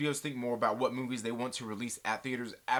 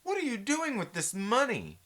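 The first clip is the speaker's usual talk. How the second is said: higher, slow disbelief at the spending; a mock incredulous outburst, higher; 9 semitones higher than usual. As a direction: gasp out Jesus Christ in shock. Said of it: "and it's like".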